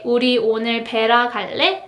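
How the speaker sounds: quick rising 우리 오늘 베라 갈래; native-speed question; fast everyday Korean question; fast casual Korean. Shown as a demonstration. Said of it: "So for example, you can say".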